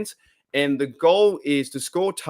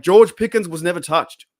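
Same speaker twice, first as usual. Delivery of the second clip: quoting a comment; 4 semitones higher, 7 dB louder than usual. Retold or imitated. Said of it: "someone said to me in a comment".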